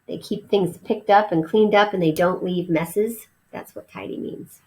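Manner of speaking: usual speaking voice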